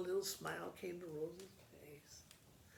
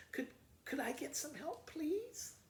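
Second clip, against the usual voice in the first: fake sweet plea; 9 semitones higher than usual. Rose asks sweetly for help.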